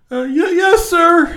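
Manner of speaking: very high, timid yes sir; tiny frightened falsetto sir; scared squeak. Retold or imitated. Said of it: "I'm so scared".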